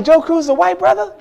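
high, incredulous question; amazed disbelief. Retold or imitated.

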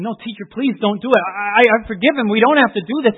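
loud, desperate pleading, voice breaking; a boy begging loudly for his friend; pleading cry. Acted. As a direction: beg the teacher to stop.

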